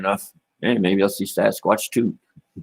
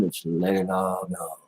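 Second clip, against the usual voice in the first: ordinary talk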